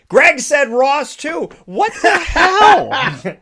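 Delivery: high, loud, slow outraged shout with a laugh; dad's outraged yell. Retold or imitated. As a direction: yell it like an exasperated dad. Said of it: "my dad was like".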